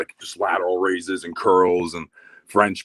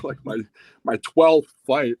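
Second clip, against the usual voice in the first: ordinary talk, nothing marked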